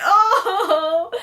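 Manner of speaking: high, loud, drawn-out "oh" squeal; gushing aww. Retold or imitated. Fan retelling her reaction to a sweet moment, gushing squeal. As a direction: melt out loud with an exaggerated aww.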